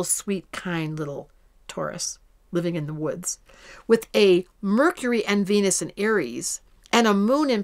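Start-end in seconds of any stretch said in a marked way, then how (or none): 0.0-3.4 tender. Acted. Describes gentle Taurus tenderly.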